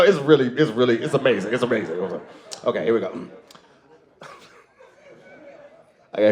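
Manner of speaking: normal talking voice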